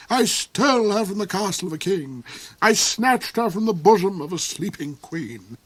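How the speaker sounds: soar up high and gloat over her; the Duke's high voice. Acted.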